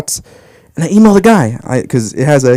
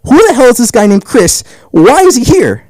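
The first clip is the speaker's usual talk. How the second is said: higher-pitched mock outrage; indignant crowd, imitated; 8 semitones higher, 3 dB louder than usual. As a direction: act out baffled onlookers complaining. Voices the baffled audience.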